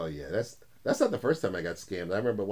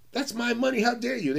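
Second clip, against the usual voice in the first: mock outrage; 11 semitones higher than usual. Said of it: "It was like".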